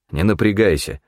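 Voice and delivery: usual speaking voice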